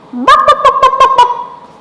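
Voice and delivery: high, loud rapid-fire yapping; badly faked dog, squeaky and loud; chicken-like dog bark. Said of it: "That sounded more like a chicken".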